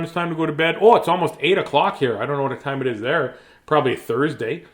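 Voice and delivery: ordinary delivery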